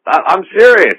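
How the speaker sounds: exasperated, hammering the point home; loud, exasperated insistence at the pushy interviewer. Retold or imitated. Retold back-and-forth about Loveline.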